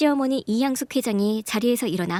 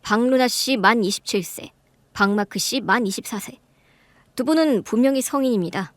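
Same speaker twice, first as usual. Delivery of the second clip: calm, firm rebuttal. Acted. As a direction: lay out the facts coolly, leave no room to argue. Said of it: "차분하고 단호하게 반박했습니다".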